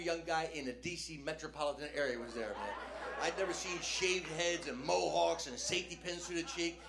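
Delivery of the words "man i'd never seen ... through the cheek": usual speaking voice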